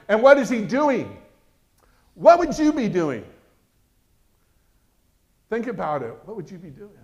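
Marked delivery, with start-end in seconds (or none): 0.1-3.2 high and loud, pressing the question on the congregation; loud preaching question. Real, not acted. 6.2-6.9 the same question dropped to near a murmur, WHAT and WOULD gently rising; soft repeat. Real, not acted. Same question asked again softly.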